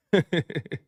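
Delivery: quick real chuckle; amused chuckle at a chat joke; short laugh. Real, not acted. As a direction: let a laugh slip out.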